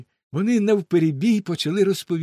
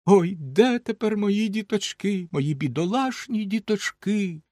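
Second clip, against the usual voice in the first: slow, higher wail of grief; crying mother; 7 semitones higher than usual. Acted. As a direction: mourn the lost children aloud. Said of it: "І вона голосно заплакала". (материн плач, повільно)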